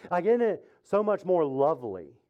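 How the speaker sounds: ordinary talk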